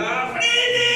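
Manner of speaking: high mock yell to brake; a driving teacher yelling at the learner to brake; mock shouted brake. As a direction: cry out like a panicked driving teacher. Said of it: "me grita".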